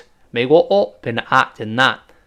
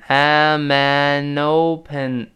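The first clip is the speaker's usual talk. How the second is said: am an open drawn out very slowly, joined; very slow linking; much slower than usual. Shown as a demonstration. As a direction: crawl through am an open. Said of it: "都连到一块儿了".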